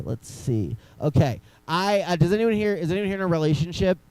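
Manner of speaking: ordinary talk, nothing marked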